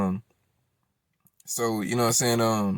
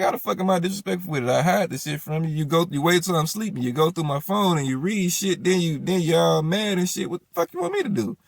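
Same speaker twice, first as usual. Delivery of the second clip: higher-pitched, rolling retort; indignant arguing; 7 semitones higher than usual. Retold or imitated. Man answering baby mama's accusation of disrespect, indignant. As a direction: pile up complaints in a heated retelling. Retold quarrel, defending himself.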